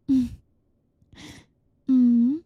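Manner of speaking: ordinary delivery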